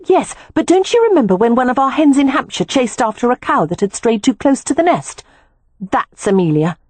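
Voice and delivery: a hint of a younger sister, eager; lightly voiced Beatrix, lively.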